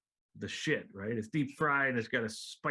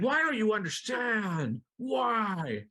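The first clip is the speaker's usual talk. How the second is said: mock yelling; 8 semitones higher, much slower than usual. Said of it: "I'm yelling at the well".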